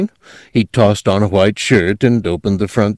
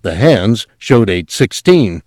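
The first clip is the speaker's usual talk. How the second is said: plain reading voice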